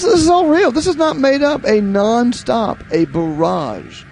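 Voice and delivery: normal talking voice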